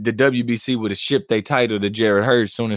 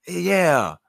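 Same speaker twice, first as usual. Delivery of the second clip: mock fighter hype; 6 semitones higher, slower than usual. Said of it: "go back to his corner".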